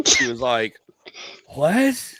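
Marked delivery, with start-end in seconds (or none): 1.5-2.1 high, rising cry of disbelief; shocked wife. Retold or imitated. Wife hearing the beer news, high and shocked. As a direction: imitate a stunned wife, pitch shooting up. Retold wife's shocked reaction.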